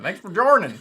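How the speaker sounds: mock Southern accent saying joining. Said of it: "My, my southern accent".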